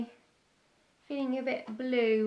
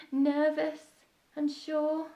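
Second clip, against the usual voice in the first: ordinary delivery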